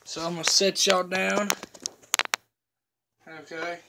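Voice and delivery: lip packed with dip; chewing tobacco. Real, not acted. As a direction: talk around a lip of dip. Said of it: "pack a lip".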